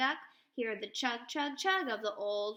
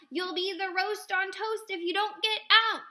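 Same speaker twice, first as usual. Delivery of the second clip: loud, high angry cook yelling; angry shout; 6 semitones higher, 7 dB louder than usual. A narrator's reading. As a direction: bellow it like a furious cook chasing them out. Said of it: "with an angry shout".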